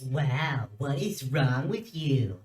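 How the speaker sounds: low, slow, nasal mimicry; nasal mocking voice. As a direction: parrot him back in a nasal whine. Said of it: "parroted Derek's words in a nasally voice".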